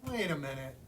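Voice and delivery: ordinary delivery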